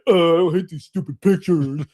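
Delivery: imitating a fed-up kid's groan; mock whine, dragging out the protest; whiny complaint. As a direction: groan it like a kid who hates this. Voicing kids sick of the photos.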